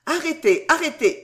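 urgent halt. A narrator's reading. Gentleman stopping his servant at the wall, urgent halt. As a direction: call out sharply to halt them.

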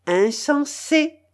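high, loud, drawn-out playful cry; playful teasing. A narrator's reading. Woman taps the fat man's shoulder, charmingly playful. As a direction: tease with charming, lilting playfulness. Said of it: "avec le plus charmant enjouement".

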